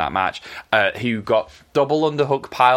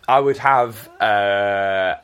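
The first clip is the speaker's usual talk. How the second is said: ordinary talk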